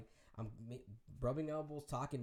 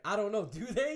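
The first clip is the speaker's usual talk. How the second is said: loud, high playing dumb; mock-innocent comeback; 9 semitones higher, 11 dB louder than usual. [name] teasing the DJ, loud mock innocence. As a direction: toss the joke back loud and high.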